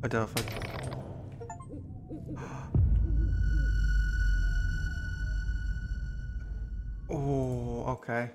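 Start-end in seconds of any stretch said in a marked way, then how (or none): 0.5-7.0 shrill gasp. Real, not acted. Jump scare gasp.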